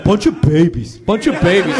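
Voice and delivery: loud mock grumble of dismissal; disgusted boss. Boss walking out on his workers, mock disgust. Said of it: "walk out shaking his head going".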